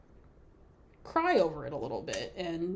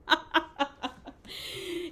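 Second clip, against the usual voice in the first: high-pitched giggling fit; amused laughter; 9 semitones higher than usual. Real, not acted. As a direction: laugh at being teased. Laughs at a viewer's comment.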